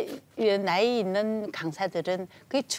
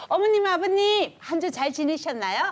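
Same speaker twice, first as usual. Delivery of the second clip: higher, louder bright greeting to seniors; cheerful instructor greeting; 7 semitones higher, 5 dB louder than usual. Shown as a demonstration. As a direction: greet the class warmly and loudly. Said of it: "따라해 보시겠어요".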